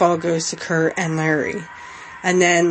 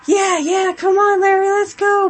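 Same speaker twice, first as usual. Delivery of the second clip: imitating crowd cheer; 13 semitones higher than usual. Voicing the cheering crowd, a high mock chant. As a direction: cheer like a fan in the stands. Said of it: "you can hear the crowd just".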